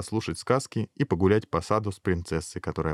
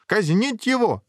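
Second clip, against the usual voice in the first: very high, outraged order; royal command; 13 semitones higher, 3 dB louder, slower than usual. A narrator's reading. King ordering the guards to execute, high outraged command. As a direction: fling out the order in a high cry. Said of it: "велел король".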